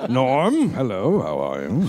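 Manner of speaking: very low, rich radio-host voice; Frasier. Acted. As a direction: drop deep and plummy like a sitcom shrink.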